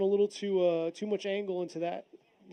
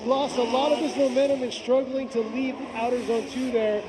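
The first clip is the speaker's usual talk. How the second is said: raised, louder excited race call; live play-by-play; 5 semitones higher, 6 dB louder than usual. Real, not acted. Commentator calling Goto's second run, excited play-by-play. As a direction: push the energy as the car struggles.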